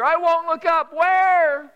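put-on high, loud child's promise; eager little boy. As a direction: promise it breathlessly like a kid. Child making promise to grandma.

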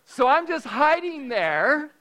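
normal talking voice

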